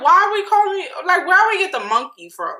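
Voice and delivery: high, loud bewildered questioning; baffled disbelief. Retold or imitated. Vlogger reacting to the drunk monkeys remark, baffled.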